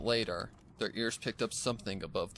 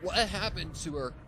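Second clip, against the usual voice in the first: shocked; 9 semitones higher, 3 dB louder than usual. Acted. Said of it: "in a state of shock".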